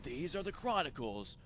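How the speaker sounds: low dramatic trailer announcer; movie trailer voiceover. Pretend. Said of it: "my movie trailer voiceover needs a little bit of work".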